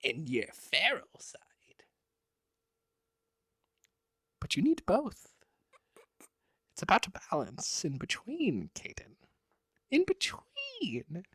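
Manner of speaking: a lightly hammed-up sage lecturing on balance; mock wizard voice. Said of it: "What is this accent?".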